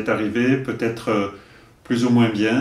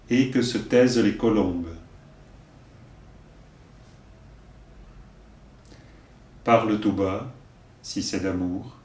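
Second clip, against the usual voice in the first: poem recitation; 2 semitones lower than usual. Acted. Teacher giving verses for learners to repeat, measured recitation. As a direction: recite line by line, leaving room to repeat.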